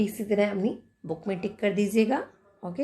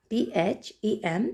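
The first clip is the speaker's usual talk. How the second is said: normal talking voice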